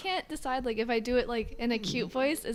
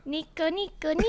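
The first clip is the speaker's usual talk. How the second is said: put-on high, cutesy anime girl catchphrase; a squeaky, cutesy sing-song; 8 semitones higher than usual. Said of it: "You have to do it in a really cute voice".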